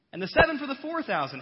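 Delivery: high, loud and fast pressing; high fast loud insistence; insistent question. Acted. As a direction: fire the question at them. Jesus asks again, insistent.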